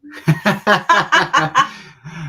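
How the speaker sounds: real burst of high, loud laughter; hearty laugh. Real, not acted. Husband asked what he was doing over there, laughing hard. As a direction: crack up at being put on the spot. Teased by the host's question.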